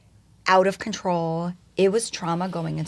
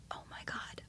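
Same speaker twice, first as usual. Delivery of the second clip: barely audible, voiceless gasp of words; awed whisper; 21 dB quieter than usual. Retold or imitated. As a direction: let it slip out in a whisper. Overwhelmed realisation before crying.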